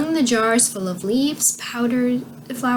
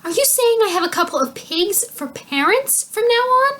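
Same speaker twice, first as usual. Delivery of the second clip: high-pitched horrified outcry; 12 semitones higher, 4 dB louder than usual. A narrator's reading. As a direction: rise into appalled disbelief.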